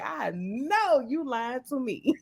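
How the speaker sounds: slow, laughing through the words; laughing accusation. Retold or imitated. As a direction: tease her while laughing. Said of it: "I said".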